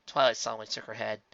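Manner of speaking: ordinary delivery, nothing marked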